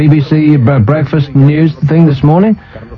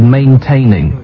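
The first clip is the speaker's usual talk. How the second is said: maintaining, slow and deliberate, every syllable weighted; stressed word; slower than usual. Shown as a demonstration. Host pointing out a minister's word, stressed slowly. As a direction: lean hard on maintaining. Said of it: "note that word".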